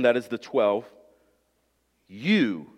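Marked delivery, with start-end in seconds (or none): 2.1-2.7 YOU set apart and drawn out; emphatic you. Acted. Jesus handing the twelve the task, stress on YOU. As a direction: lean on YOU, then pause.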